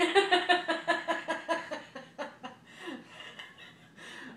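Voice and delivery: bright burst of laughter; loud laugh. Real, not acted. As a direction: laugh with delight. Toddler reached, mother laughs.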